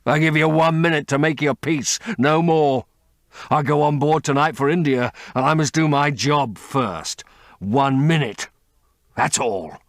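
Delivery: a brother who has hunted for years; vengeful brother. A narrator's reading. James Vane's ultimatum.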